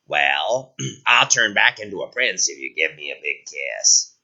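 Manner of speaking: low, croaky frog prince. Acted. Frog speaks.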